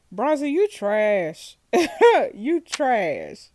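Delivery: slow, loud contempt; scornful insult. Real, not acted. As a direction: spit it at him. Gamer insults racist suspect.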